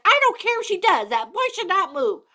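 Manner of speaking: second cat snaps back, fast and high; snapping cat. Acted. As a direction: bite back quickly like a cross cat. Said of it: "the other cat snapped".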